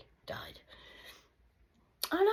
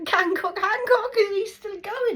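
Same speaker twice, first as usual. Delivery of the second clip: laughing; 16 dB louder than usual. Real, not acted. Laughing over a name.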